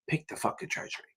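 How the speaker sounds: hushed, breathy whisper of the word treasury; whispered curse. Real, not acted. Tutor whispering the answer to exam students. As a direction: drop to a whisper to drive the point home.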